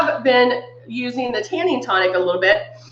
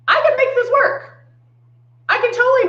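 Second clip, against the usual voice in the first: mock chirpy; 13 semitones higher than usual. Mock cheerful claim.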